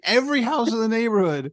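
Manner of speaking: high-pitched disbelieving question; can't believe what you just heard; incredulous echo. Retold or imitated. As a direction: repeat it back in amazed disbelief. Retells his disbelief at son's plan.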